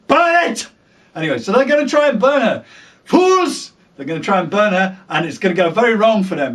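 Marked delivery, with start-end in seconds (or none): 0.1-0.7 high mock command; mock zealot cry. Mocking the Red Women, high mock cry. 3.1-3.7 high scornful exclamation; mock villain cry. Narrator mocking the burners, high scornful cry.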